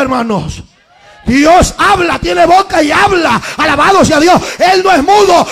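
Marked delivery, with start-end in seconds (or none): 1.3-5.5 loud, high, ringing preacher's cry; preaching at full force. Acted. Preacher shouting to the congregation, full-force preaching. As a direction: proclaim it to the congregation with all your voice. Preaching climax, music behind.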